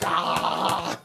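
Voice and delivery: whispered, unvoiced scared "ah"; mock terrified gasp; breathy mock cry. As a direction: breathe out a fake fright cry. Imitating scaring the wicked ones.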